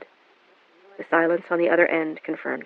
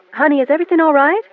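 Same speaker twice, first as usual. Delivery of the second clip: alarmed rush; 10 semitones higher, 8 dB louder, faster than usual. A narrator's reading. Alarmed mother on phone.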